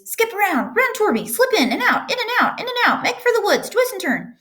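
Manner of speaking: shouting goose. A narrator's reading. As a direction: squawk orders like a bossy goose. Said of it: "cried the goose".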